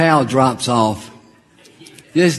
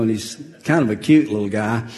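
ordinary delivery

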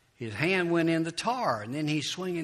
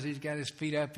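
ordinary talk, nothing marked

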